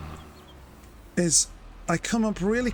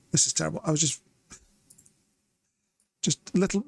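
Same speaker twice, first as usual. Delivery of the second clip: ordinary talk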